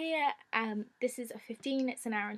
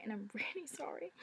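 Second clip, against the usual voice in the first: whispered apology; 8 dB quieter than usual. Real, not acted. Apologising for mispronouncing a name.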